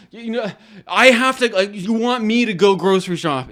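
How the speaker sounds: hammed-up household gripe, swearing in frustration; exasperated. Complaining to housemates about the empty fridge, exasperated.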